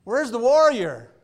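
high, loud, slow bellowing "where's the warrior"; mock giant shout. Preacher voicing Goliath searching the field, mock bellow. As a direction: boom it out like a scornful giant. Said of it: "He's looking around".

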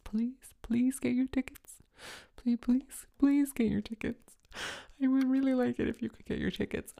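high, soft begging; pleading. Real, not acted. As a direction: beg them sweetly to buy. Begging audience to buy tickets.

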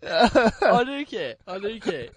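slow, laughing protest. Real, not acted. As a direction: insist while laughing.

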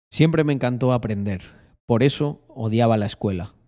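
usual speaking voice

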